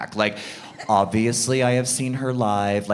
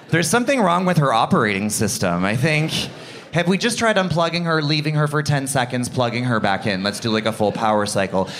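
normal talking voice

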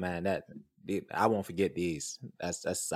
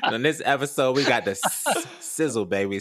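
words pushed out through real laughter, louder and higher; laughing while talking; 5 semitones higher, 6 dB louder than usual. Real, not acted. Cohost wraps the episode for listeners, laughing. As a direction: still giggling from the joke as he recaps. Laughing after the invoice joke.

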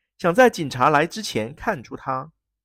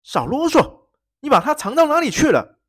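loud, high, impatient shout; angry demand; 12 semitones higher, 8 dB louder than usual. Acted. Prince cutting the baron off, shouting angrily. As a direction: cut him off and shout the question.

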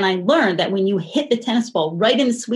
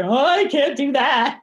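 higher, drawn-out mock whine; mock protest; 6 semitones higher than usual. Young writer balking at dad's student paper idea, mock protest. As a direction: play up a reluctant kid's protest. Acting out her own reluctant reply.

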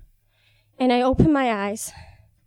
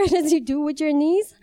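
ordinary delivery

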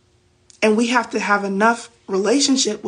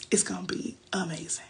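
whisper; 3 semitones lower, 12 dB quieter than usual. Retold or imitated. Voiced God's promise trails to whisper.

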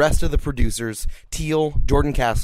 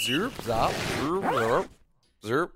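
vocal zip zip sounds; zipper noises; 2 semitones higher, 4 dB quieter, much slower than usual. Retold or imitated. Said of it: "my zippers are very loud".

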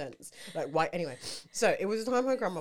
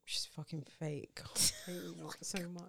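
hushed, unvoiced aside; whisper; 7 semitones lower, 12 dB quieter than usual. Real, not acted. Snapping at [name] doubters in a hushed whisper. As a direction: whisper it like a secret insult.

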